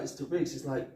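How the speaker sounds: normal talking voice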